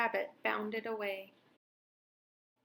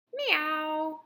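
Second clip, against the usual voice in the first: a louder drawn-out meow; cow meow; 5 semitones higher, 4 dB louder than usual. A narrator's reading. Cow surprises Bebe with a friendly meow. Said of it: "said the cow".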